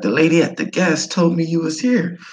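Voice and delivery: high, eager broken English; imitated Vietnamese English. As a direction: coax the guest to eat. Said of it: "He's like".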